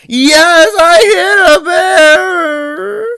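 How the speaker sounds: high, very slow sobbing wail; imitated bawling. Brother crying to mom on the phone, slow high sobbing. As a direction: blubber it out through tears. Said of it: "just him bawling on the phone".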